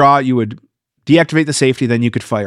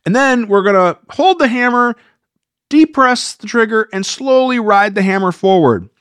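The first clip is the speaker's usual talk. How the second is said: much higher, prissy mock voice; mocking their advice; 7 semitones higher than usual. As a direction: mimic the people giving bad advice. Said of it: "I've seen this where they say".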